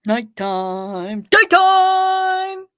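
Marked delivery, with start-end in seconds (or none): 1.3-2.7 Daytime! cried high and loud; high loud cry. Pretend. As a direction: yell Daytime! much higher and louder. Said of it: "it's the nighttime, daytime one".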